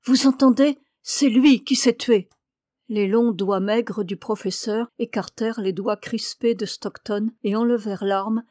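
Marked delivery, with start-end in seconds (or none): 0.0-2.3 loud, raised, insistent denial; defiant young woman, each phrase hammered out louder; defiant cry. A narrator's reading. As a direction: cry out your innocence with defiance. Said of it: "d'une voix pleine de défi, elle s'écria".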